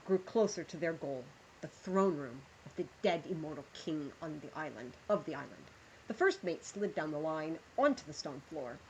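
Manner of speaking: measured storyteller reading aloud; story narration. Acted. Streamer reads the pirate story to her chat, storyteller voice. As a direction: draw listeners into the tale.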